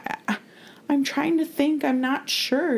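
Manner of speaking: put-on high, nervous child's stammer; a small girl stalling, unsure and flustered; flustered little girl. The sister stammers nervously when Rory questions her. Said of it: "And she's like".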